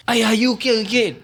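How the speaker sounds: high-pitched alarmed fussing; exaggerated worried parent, pitch shooting up; overreacting parent. Shown as a demonstration. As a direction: fuss over a fallen child in mock panic. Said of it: "when you overreact about something".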